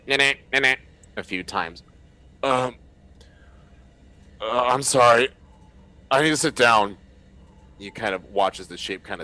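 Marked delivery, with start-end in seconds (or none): 0.1-0.8 higher, louder mock computer denial sound; garbled error buzz. GM mimics the door panel refusing the code, buzzing. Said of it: "you hear the". 2.4-7.0 higher, louder woozy survivor voice; an exhausted man asking for a seat; dazed survivor. Acted. Confused survivor tells the crew he must sit down.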